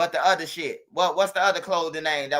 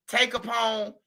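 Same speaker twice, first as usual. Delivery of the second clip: ordinary delivery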